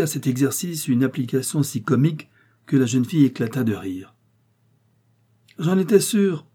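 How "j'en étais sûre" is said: high, loud busybody cry; old woman bursting in, chattering; chatty exclamation. A narrator's reading. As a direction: blurt it out like a chatty old lady. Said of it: "fit ... la voix bavarde".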